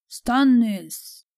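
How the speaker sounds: high, loud, held cry; shout. A narrator's reading. Davos shouts his king's name to the Lysene, loudly. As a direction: shout the name across to the ship. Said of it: "diye bağırdı Davos Lee'sli'ye".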